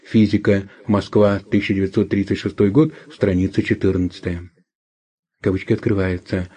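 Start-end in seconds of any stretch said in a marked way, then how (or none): 5.4-6.5 quiet aside for the quote marks; dictating. Real, not acted. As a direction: read the punctuation out softly. Reads out quote marks aloud. (диктовка вполголоса)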